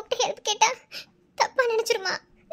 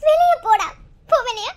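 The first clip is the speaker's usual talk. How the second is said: louder, crying yell; tearful angry shout; 4 dB louder than usual. Acted. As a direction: throw him out through tears. Crying girl drives him out. (அழுதபடி கோபக் கத்தல்)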